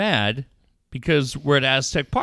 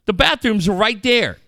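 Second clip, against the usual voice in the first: raised, indignant mock yell; imitated kid's yell; 6 semitones higher, 4 dB louder than usual. Dad repeating his son's yell at a mom, mock yelling. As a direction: call it out like an outraged kid. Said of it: "He yells at her".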